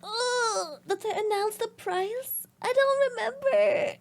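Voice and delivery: high whiny fake crying; mock whine. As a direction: whimper like a spoiled kid.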